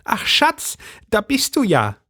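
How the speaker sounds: high agitated housewife voice; Brigitte's voice. A narrator's reading. Brigitte greets husband.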